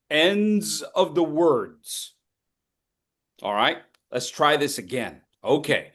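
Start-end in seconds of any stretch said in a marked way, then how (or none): 0.1-2.1 Ends of the words slow, every final sound clear; crisp word ends. Shown as a demonstration. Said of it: "hit the ends of our words".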